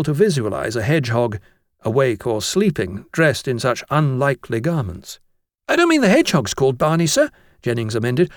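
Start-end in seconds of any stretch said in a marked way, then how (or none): 5.7-7.3 fast, high-pitched correction; eager schoolboy. Acted. Jennings corrects himself to the master, fast high voice. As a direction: hurry to clear up the muddle.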